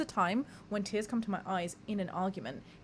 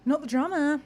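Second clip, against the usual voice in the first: loud catchphrase; 6 semitones higher, 8 dB louder than usual. Acted.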